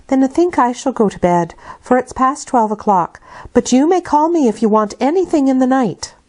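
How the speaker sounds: put-on a servant saying goodnight to the child; Bessie the nurse. Bessie voiced by reader.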